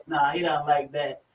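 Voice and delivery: ordinary delivery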